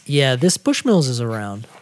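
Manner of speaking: normal talking voice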